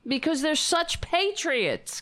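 high mocking mock-admiration; sarcastic praise. Host mocking anthem-singing protesters, high sarcastic praise.

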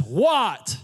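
retold annoyed "what", falling; a mock-angry, punched one-word reply; mad. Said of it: "real mad".

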